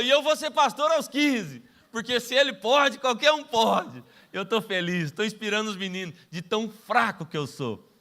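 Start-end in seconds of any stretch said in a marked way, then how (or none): 0.0-4.0 put-on excited kid. Boy's boast retold with laughter.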